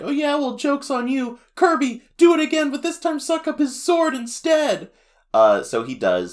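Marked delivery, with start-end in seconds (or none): 0.0-4.9 put-on very high, louder taunting kid; Tiff voice. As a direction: gloat like a bratty kid. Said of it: "Tiff is like".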